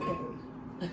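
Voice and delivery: a whispered, melting okay; breathy whisper. Retold or imitated. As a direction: breathe it out as if swooning. Breathless reaction to an attractive person.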